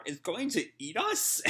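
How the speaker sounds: a small creature panicking; mild mock fright, high; squeaky alarm. Happy freaks out.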